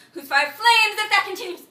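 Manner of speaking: high, loud declamation; sudden outburst. Acted. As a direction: flare up loud and high mid-speech. Acted stage monologue, sudden outburst.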